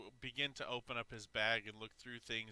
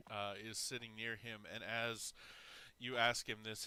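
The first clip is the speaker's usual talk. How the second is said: ordinary delivery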